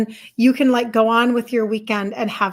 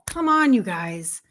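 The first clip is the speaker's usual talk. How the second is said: usual speaking voice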